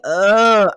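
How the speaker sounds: long, loud mock groan; exasperated groan. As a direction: groan it loudly, imitating someone fed up. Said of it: "she literally groans in his face".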